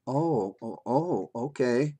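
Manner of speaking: slow, let-down little "oh, okay"; mild mock disappointment, drawn out; crestfallen child. Child put off by busy mother, crestfallen.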